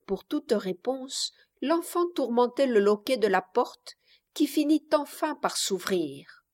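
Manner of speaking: plain reading voice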